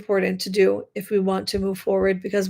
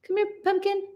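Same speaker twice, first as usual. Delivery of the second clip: much higher, softer pet-talk voice; cooing to a cat; 11 semitones higher, 5 dB quieter than usual. Real, not acted.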